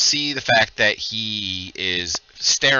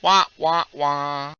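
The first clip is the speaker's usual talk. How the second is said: muffled wah wah talk; 5 semitones higher, much slower than usual. Pretend. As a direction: mimic a sad trombone, sung down. GM echoes sad trombone.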